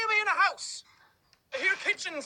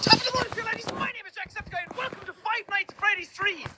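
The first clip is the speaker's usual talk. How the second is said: energetic intro; 5 semitones higher than usual. Acted. Gamer opening his video to viewers, energetic. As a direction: burst in with the channel greeting. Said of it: "channel intro".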